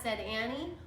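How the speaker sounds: plain reading voice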